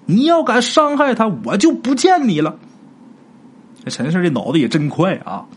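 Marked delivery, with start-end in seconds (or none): 0.0-2.6 high, sharp threat called out; urgent shout. Acted. Young man shouting a threat at the ghost mother. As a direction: shout the threat to stop her. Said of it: "陈四儿赶紧又喊一声".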